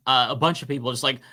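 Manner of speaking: ordinary talk, nothing marked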